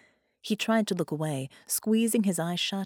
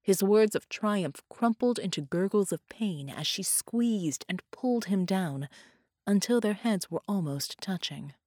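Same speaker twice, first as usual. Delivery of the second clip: ordinary delivery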